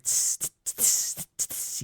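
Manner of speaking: soft unvoiced hi-hat tss tss; imitated hi-hat. Salesman mimics cool jazz in the store, soft hi-hat hiss. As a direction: tap out a jazz cymbal with your mouth.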